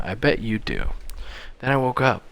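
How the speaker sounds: ordinary talk, nothing marked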